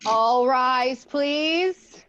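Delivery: loud courtroom call to rise; judge's call. Acted. Judge calling the court to order, loud. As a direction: boom it out like a court officer.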